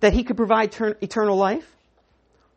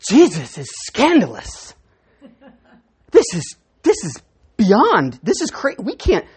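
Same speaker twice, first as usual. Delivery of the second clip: louder, slow, scandalized complaint; mock outraged grumbling; 5 dB louder than usual. Disciples scandalized by Jesus' teaching, mock outraged. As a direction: ham up the offended disciples. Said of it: "That's the grumbling".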